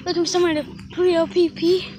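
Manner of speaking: ordinary talk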